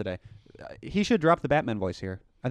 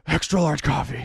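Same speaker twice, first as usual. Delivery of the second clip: Batman voice. Acted. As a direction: push out the line in a put-on Batman voice. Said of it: "He's more like".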